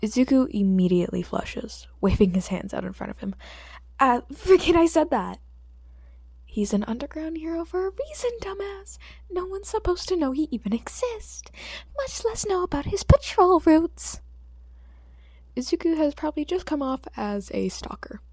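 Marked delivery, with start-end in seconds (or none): 4.0-5.4 flustered. Acted. Flustered boy blurts correction. 6.4-14.2 higher, hurried self-scolding; a nervous teen hissing at himself; lightly voiced flustered boy. A flustered boy scolding himself, a touch higher.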